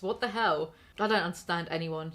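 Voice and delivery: normal talking voice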